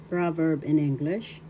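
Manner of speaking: ordinary talk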